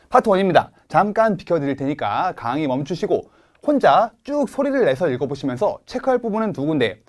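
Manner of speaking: normal talking voice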